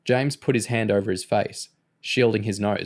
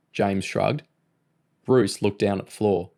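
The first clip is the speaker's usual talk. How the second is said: ordinary delivery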